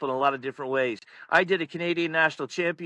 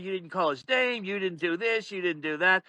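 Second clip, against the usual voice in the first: normal talking voice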